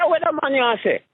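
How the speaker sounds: put-on a girl squinting, what did you say; high girl voice. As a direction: raise the pitch for the confused girl. Girl voiced in retold story.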